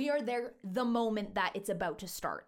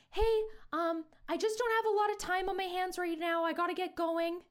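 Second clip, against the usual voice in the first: high-pitched imitation of a friend; raised-pitch retold excuse; friend's awkward approach; 6 semitones higher than usual. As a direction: act out a hesitant, higher-voiced approach.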